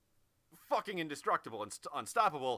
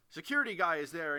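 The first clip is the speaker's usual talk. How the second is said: ordinary talk, nothing marked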